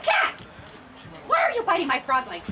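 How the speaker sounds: high, loud scolding outburst; acted exasperated yell at a pet; mad witch. Witch demanding answers from her cat, mad and loud. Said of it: "she was really getting mad".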